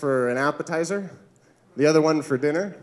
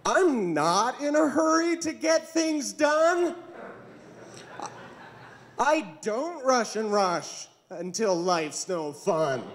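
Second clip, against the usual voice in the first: slow, higher, unhurried drawl; a woman taking her time, slow and higher; leisurely typical woman; 5 semitones higher, slower than usual. Acted. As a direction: stretch every word, in no rush. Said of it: "my impression of a typical woman".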